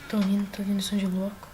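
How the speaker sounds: ordinary talk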